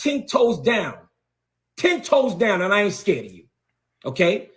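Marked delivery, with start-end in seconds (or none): none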